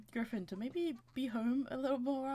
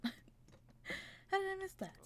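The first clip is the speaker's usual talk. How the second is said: high, slow, drawn-out question; laugh, then squeak it out slowly; incredulous at oneself, pitched right up; amused disbelief; 9 semitones higher, 4 dB quieter, much slower than usual. Real, not acted. Laughing at herself for overlooking chest.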